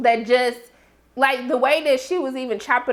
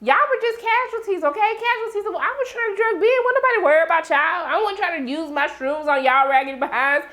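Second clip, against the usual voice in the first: mock Misty voice; 6 semitones higher than usual. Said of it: "But she was like".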